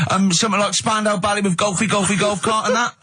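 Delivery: high, eager mock caller; a fan phoning the breakfast show, high and eager. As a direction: gabble like a desperate phone-in caller.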